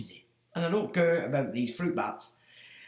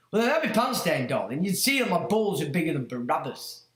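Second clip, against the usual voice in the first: ordinary talk, nothing marked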